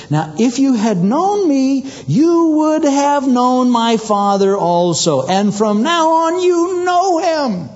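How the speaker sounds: high, drawn-out words; slow, emphatic. Acted. Jesus explaining to the apostles, slow and emphatic. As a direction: spell it out very slowly, pitch climbing.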